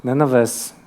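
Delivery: ordinary talk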